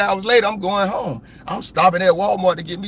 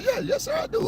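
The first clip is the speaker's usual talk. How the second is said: much higher, soft polite woman voice; imitating the officer; 5 dB quieter than usual. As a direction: mimic her sweet, polite reply. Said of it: "She said".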